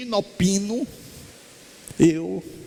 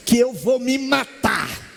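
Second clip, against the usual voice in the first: threat shouted; 6 semitones higher than usual. Retold or imitated. Woman threatens suicide to speaker.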